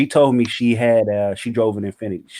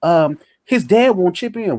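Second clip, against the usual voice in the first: higher, lightly voiced girlfriend asking for money; mimicking girlfriend; 9 semitones higher than usual. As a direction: imitate her breezy request, pitched up.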